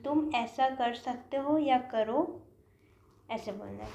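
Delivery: ordinary talk, nothing marked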